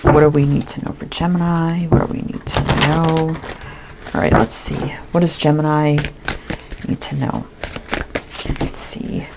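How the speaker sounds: softly murmuring questions to herself; quiet murmur. Real, not acted. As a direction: hush it, asking the cards under her breath. Murmuring questions to the cards while shuffling.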